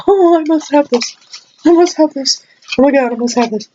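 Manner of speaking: high, eager gushing over the polish; mock excited craving. Vlogger mimics herself craving the polish, mock excited. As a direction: pretend to swoon for the bottle. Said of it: "the one that I kept going".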